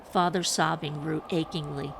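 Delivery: ordinary delivery, nothing marked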